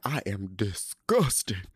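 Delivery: slow, drawn-out mock disgust; Erica. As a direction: imitate an appalled woman, dragging each word. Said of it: "she's crying".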